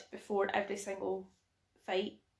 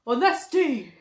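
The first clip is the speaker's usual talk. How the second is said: loud rallying shout from the film; mock battle cry; 3 semitones higher, 11 dB louder, much slower than usual. Friend declaiming Braveheart line, loud rallying shout. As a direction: bellow it to the troops.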